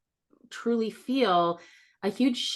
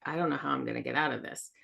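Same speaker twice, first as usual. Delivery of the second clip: hopeless mutter; 5 semitones lower, 5 dB quieter, faster than usual. Retold or imitated. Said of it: "it kind of feels like".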